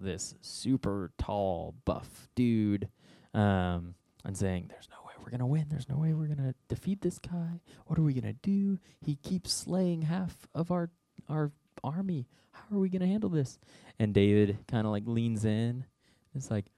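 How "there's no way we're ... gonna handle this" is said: high, hushed panicky voices, partly whispered; scared soldiers. Acted. Israelite soldiers fretting about the giant, hushed and panicky. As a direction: whisper the fear, then rise to panic.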